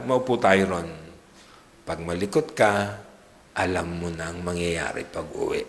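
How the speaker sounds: put-on mother quietly warning her child in church not to fidget; stern hushed mother. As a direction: hush the kid firmly so nobody else hears. Mother's hushed threat continues.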